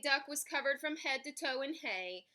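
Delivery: plain reading voice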